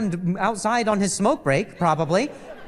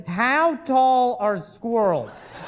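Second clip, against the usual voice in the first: slow, earnest, higher reading with a laugh; mock search reading; 5 semitones higher, much slower than usual. Wife's laptop search read aloud, slow mock earnestness. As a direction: read it out like an absurd earnest query. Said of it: "a Google search that says".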